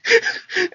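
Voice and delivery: a sudden high cackle; high giggle fit; high laugh burst. Real, not acted. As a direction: break into a squeaky laugh. Laughing mid-story.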